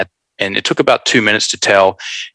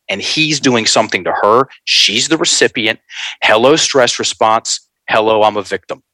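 ordinary delivery

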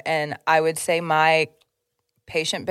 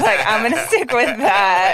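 higher, drawn-out laughing delivery; amused; 5 semitones higher than usual. Real, not acted. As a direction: say it slowly through a laugh. Punchline of her own story, laughing.

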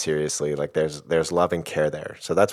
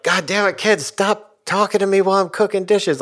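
put-on much higher and louder, snapping at the kids; controlling angry yell; 6 dB louder than usual. Shown as a demonstration. Father yelling at kids while cooking: loud angry shout. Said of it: "I'm trying to control them".

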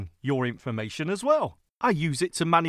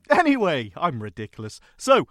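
usual speaking voice

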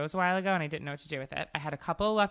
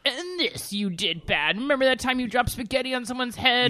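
mocking inner critic; 6 semitones higher, 4 dB louder than usual. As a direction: play the friend's voice scolding in her head. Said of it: "I had your voice in my head".

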